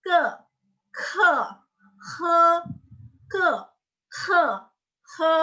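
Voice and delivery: high, separate "ke" from the back of the tongue; back-tongue sounds. Shown as a demonstration. Teacher showing pangkal lidah, high back-tongue syllables. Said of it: "pangkal lidah itu".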